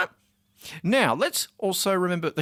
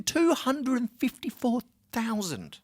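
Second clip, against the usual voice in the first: TWO HUNDRED FIFTY-FOUR THOUSAND drawn out slowly and high; emphatic repeat; 7 semitones higher, slower than usual. Real, not acted. Host driving home the attendance figure, emphatic.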